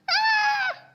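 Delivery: piercing high bird screech; a soaring falcon's shriek. Acted. Tess seeing the mirror, falcon screech. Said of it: "let out the screech like a soaring falcon".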